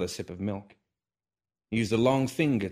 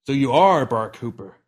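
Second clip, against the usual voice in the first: louder insistent question; 4 semitones higher, 10 dB louder than usual. Acted. Hiccup presses old man.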